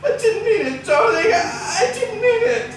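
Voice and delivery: high, sobbing plea; distraught pleading. Acted. Husband pleading with his dying wife, near tears. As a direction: beg forgiveness, near tears.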